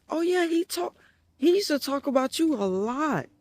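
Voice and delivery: a hint of a sweet girlfriend. Girlfriend voiced in retold story.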